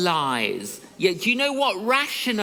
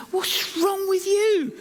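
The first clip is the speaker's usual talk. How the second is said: high, slow mock wife voice; wife asking; 12 semitones higher, slower than usual. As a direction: voice the wife, pitched up and drawn out. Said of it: "Killy says to me".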